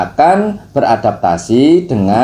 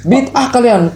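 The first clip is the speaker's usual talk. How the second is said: high-pitched hammed-up scolding, laughing at the end; mock angry scolding; 8 semitones higher than usual. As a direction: play a stubborn rock bossing the bathtub around. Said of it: "Jadi dia marah-marah batu ini".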